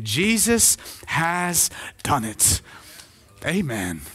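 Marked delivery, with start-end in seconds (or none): 0.0-2.6 "Jesus has done it", very drawn out, word by word; "Jesus has done it" at a very slow, deliberate pace; emphatic repetition. Real, not acted. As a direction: leave big gaps in "Jesus ... has ... done it". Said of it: "I'm gonna say it again".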